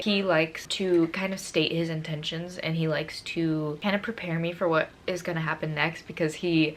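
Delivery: ordinary talk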